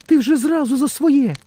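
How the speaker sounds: put-on very high little girl character; Romashka. A narrator's reading. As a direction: scold in a small, high voice. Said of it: "Ромашка мовить". (високий дівчачий голосок, з докором)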